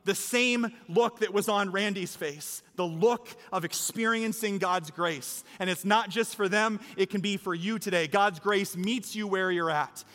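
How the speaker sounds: rousing, emphatic sermon climax; impassioned preaching. Real, not acted. Preacher urges congregation toward baptism, impassioned preaching. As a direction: drive the appeal home to the whole room. Preacher builds to closing appeal.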